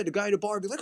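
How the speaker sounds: usual speaking voice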